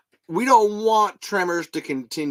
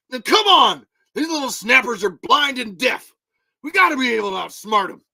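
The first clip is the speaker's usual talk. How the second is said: urgent, high-pitched character voice; rally them, raised voice starting loud; Tremors movie impression; 8 semitones higher, 5 dB louder than usual. Host acts out movie character rallying others, urgent raised voice. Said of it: "do 'em exactly in the voice".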